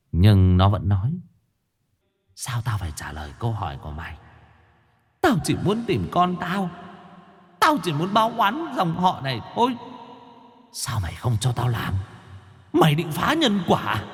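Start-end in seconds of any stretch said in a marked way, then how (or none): 2.4-4.2 hushed, low, rapid defiance; cowed but defiant ghostly undertone; defiant ghost woman. A narrator's reading. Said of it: "chẳng dám nhìn vào mắt thầy". 5.2-14.0 high, soft, wailing ghost voice; thin, anguished ghostly lament; vengeful ghost woman. A narrator's reading. Vengeful ghost woman pleads with exorcist.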